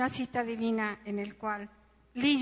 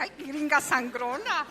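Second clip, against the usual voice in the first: higher, louder indignant scoff; annoyed retort; 5 semitones higher, 5 dB louder than usual. Retold or imitated. Narrator retells his indignant scoff at Stacy, raised and louder. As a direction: scoff at the stuck-up gringa.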